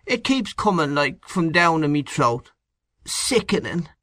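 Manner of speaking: high, whining sick man; weak whiny bedridden. A narrator's reading. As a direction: complain like a queasy invalid. Sick Kernan complaining.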